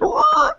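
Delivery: high hammed-up shriek of terror; mock scream. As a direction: yelp as the alien grabs him. Guy attacked in ventilation shaft.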